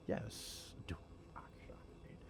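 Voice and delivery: breathy whispered curse under breath; whispering. Real, not acted. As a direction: whisper it to yourself, annoyed. Muttering at a failed game attempt.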